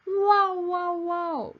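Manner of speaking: put-on high, loud childlike wow; excited yell. A narrator's reading. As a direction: yell with glee while bouncing. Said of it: "yelled Eric".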